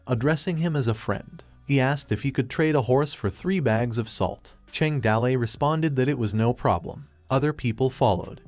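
usual speaking voice

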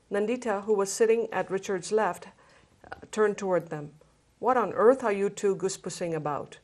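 reads the feast scene aloud to the group; story narration. Acted. Book club reader sharing a feast scene, story narration. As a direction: let the scene unfold, just reading.

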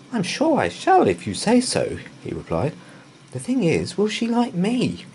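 a worried little bear asking; timid bear. A narrator's reading. Paddington anxious about meeting Mrs Bird, timid.

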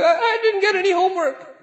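put-on high, timid child's voice with a nervous laugh; scared kid. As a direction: squeak it out like a frightened little boy. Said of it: "The kid's like".

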